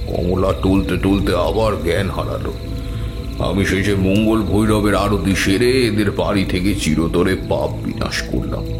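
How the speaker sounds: plain reading voice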